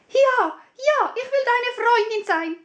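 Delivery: the princess promising everything in a rush; eager princess. Acted. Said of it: "sagte die Prinzessin". (hohe, eifrige Prinzessinnenstimme)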